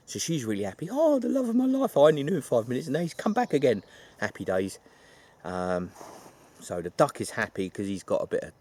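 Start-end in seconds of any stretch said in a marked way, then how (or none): 0.9-3.8 high, loud, fast gushing; mock overjoyed woman. Melissa rejoices at her love's return, high and hammed-up. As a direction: gush like a lovestruck heroine.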